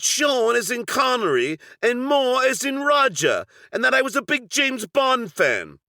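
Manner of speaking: put-on deliberately terrible English accent, posing as a caller; fake English accent. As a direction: ham up a bad English accent like a Bond fan. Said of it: "I put on a fake, awful English accent".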